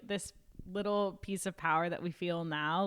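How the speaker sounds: normal talking voice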